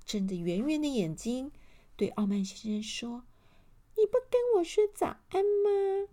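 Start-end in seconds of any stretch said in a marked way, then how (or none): 4.0-6.1 very high, put-on elf voice; little elf. A narrator's reading. Little elf asks Mr. Arrogant for a greeting, high tiny voice. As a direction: pipe it high like a tiny creature. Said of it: "有一个小小的精灵".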